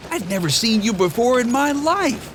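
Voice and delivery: high, fast, chattering donkey; Donkey's voice. A narrator's reading. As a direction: rattle it off, baffled. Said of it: "he said".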